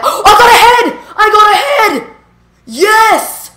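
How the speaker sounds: high excited shout with a laugh; excited yelling. Real, not acted. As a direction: yell it out in disbelief. Lucky drop, excited yelling.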